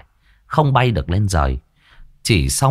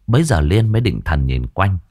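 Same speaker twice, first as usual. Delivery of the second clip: usual speaking voice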